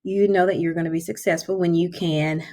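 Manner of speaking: usual speaking voice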